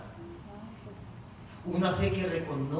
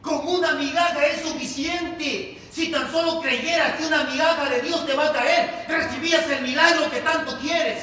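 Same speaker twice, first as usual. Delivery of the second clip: loud, raised preacher's declaration; full-force preaching; 10 semitones higher, 9 dB louder than usual. Real, not acted. Preacher urging believers to trust in God's crumbs, full-force preaching. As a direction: drive each phrase home to the congregation.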